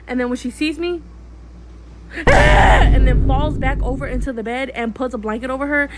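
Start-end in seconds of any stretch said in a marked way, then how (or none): 2.3-2.8 sudden very high, loud fake shriek; a toddler's staged squeal; mock shriek. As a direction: burst out in the kid's pretend shriek. Sister sees her, fake cries.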